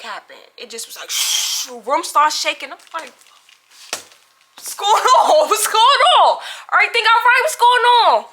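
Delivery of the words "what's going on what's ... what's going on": high, loud, panicky shouting through the door; frantic, laughing-scared call to the hallway; alarmed calling out. Retold or imitated. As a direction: yell out in a nervous laugh, asking what's happening.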